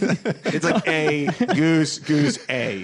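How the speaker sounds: drawn-out silly voice; slow mock Canadian. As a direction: stretch it out like a playground chant. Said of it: "They're like".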